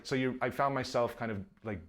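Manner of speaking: ordinary talk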